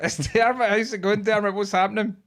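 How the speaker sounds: light up when you hear who it is; excited greeting. Real, not acted.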